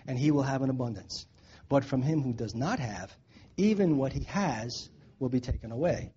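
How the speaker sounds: low, soft solemn Bible reading; scripture reading. Acted. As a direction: read the passage gravely and quietly. Reads scripture passage aloud.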